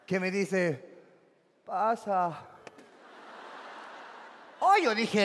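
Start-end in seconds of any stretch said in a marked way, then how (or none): 1.6-2.3 low, ghostly voice from beyond the grave; a hint of a ghostly voice from beyond the grave. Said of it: "una voz de ultratumba".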